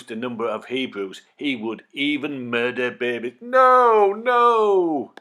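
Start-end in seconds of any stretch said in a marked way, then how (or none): 3.4-5.1 high, loud cry of horror; horrified cry. Acted. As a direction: cry out in shock at the cruelty. Horror at babies being murdered.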